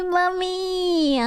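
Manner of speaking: high, slow, drawn-out coo; play-moan it in a high put-on voice; teasing, stretched-out high whine; mock swooning. Mock swooning at being yelled at.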